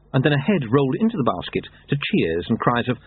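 usual speaking voice

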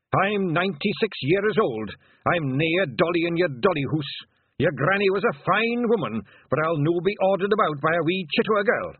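high, loud, affronted brogue; indignant Scottish elder. Acted. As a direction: scold the girl as a proud old Scot.

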